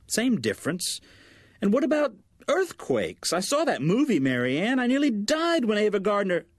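high, anxious mother running on about disasters; high worried mother; fretting mother. Acted. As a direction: pitch up into a worried, nagging mom. Narrator voices the fretting mother.

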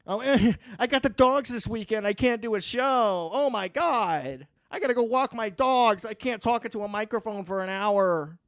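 high, mocking whine of a co-host making excuses; mock him as a whining child; whiny baby voice. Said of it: "he's a baby".

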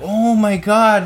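high, slow gushing "oh my God"; fake excited voice. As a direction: gush it out, overly sweet. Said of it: "and then you're like".

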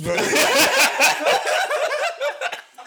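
high loud burst of laughing; cracking up; loud laughter. Real, not acted. Laughing fit.

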